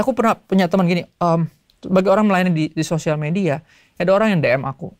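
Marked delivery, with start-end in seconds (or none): none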